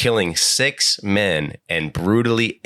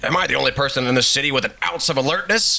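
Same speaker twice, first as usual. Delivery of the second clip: higher-pitched indignant rant; Jameson; 7 semitones higher, faster than usual. Acted. Newspaperman failing to hail a taxi, mock indignant. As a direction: play an exasperated blowhard.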